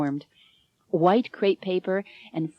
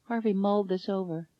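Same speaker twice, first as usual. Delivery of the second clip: plain reading voice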